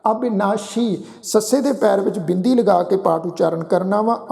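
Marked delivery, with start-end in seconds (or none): none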